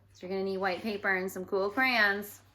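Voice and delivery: usual speaking voice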